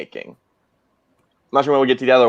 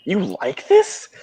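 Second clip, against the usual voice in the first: incredulous; 7 semitones higher, much slower than usual. Retold or imitated. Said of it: "everyone's like".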